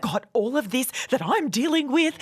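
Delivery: retold outburst, slow start climbing high; imitated exasperation. Woman retelling a row with her partner over the baby, exasperated. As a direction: drag out the complaint, then rise in pitch.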